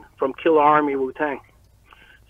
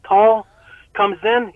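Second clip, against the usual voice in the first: normal talking voice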